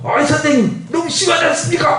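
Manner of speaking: high-pitched, outraged parent's complaint; indignant protest. Acted. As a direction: protest to the teacher, voice shooting up. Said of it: "뭐 이러고 이제 항의하는 거죠".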